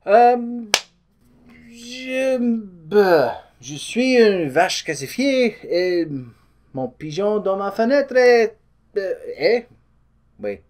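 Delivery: halting hammed-up French accent, searching for words; horrible French accent. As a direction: fumble through it in a thick fake French accent. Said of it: "it's a horrible accent".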